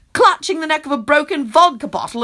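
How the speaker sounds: plain reading voice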